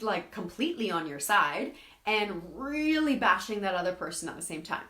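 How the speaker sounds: ordinary talk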